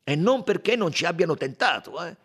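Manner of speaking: indignant Italian suspect, voice rising; defiant Italian suspect. Acted. Italian suspect brushing off the detective's question, defiant. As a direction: protest your innocence with loud emphasis.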